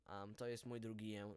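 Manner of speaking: ordinary talk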